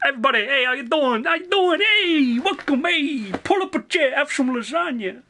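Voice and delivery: high-pitched, hammed-up Italian greeting; Italian accent. Greeting incoming raiders on stream, in a high Italian accent. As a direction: welcome them in a high mock-Italian voice. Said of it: "I don't know why I keep going Italian".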